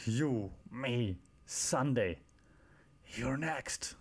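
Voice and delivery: mock wrestler challenge, word by word with long pauses; Goldberg. Reviewer mocking a wrestler's challenge, slow, whispery menace. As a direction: end in a hoarse whispered threat. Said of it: "It's like".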